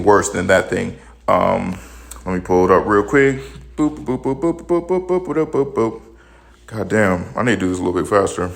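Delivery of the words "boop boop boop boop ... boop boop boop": high, sing-song boops while searching; playful boop noises. Acted. Vlogger scrolls to the next article, high playful boops. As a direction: boop along like a little beeping machine.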